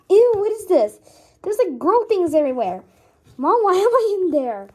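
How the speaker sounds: loud, high, disgusted cry; grossed-out outburst. Acted. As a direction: yell it like you just woke up somewhere gross. Boy wakes in girls' room.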